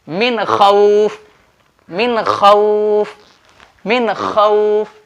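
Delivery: slow मिन खौफ, clear N, drawn-out खौफ; deliberate repeated मिन खौफ without nasal hum; izhar pronunciation. Shown as a demonstration. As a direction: stretch खौफ and keep the N of मिन distinct. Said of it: "नून को जाहिर करके पढ़ना है".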